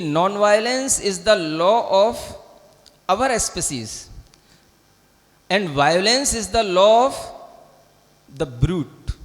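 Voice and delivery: slow, word-by-word recitation of the quote; slow quoted reading. Shown as a demonstration. Teacher to students, reciting a famous quote slowly. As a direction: read each word slowly and deliberately so students catch it.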